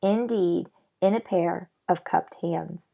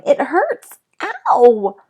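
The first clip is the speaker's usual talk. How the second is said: much higher, loud pained wail; yelling in pain; 6 semitones higher, 9 dB louder, much slower than usual. Acted. Patrick nursing his shot cheek, yelling in pain. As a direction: wail like a hurt kid. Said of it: "Patrick kept yelling".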